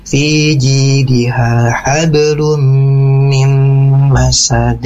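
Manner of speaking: very slow recitation, "masad" ending in an echoed d; bouncing final dal. Shown as a demonstration. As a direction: draw out each syllable and bounce the d of "masad". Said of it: "sempurnakan juga di bunyi huruf dal sukun". (bacaan pelan, "masad" memantul di dal)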